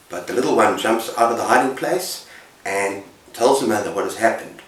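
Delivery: ordinary delivery, nothing marked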